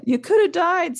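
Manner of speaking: high, loud tearful wail; hammed-up sob; acted sobbing. A narrator's reading. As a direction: cry it out in theatrical anguish. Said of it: "sobbed Hagrid".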